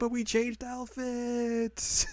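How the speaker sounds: put-on high, stretched-out girlish whine; roztrzepana wokalistka. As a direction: imitate her excuse way up high. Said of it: "She's like".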